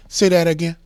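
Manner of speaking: high, loud disbelieving demand; sit bolt upright and challenge them; incredulous challenge. Acted. Listener to someone defending the man, incredulous challenge. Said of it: "you sit straight up in your chair and you say".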